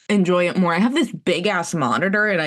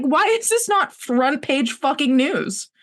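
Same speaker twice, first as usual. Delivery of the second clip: incredulous outrage; 7 semitones higher than usual. Retold or imitated. Outraged quote.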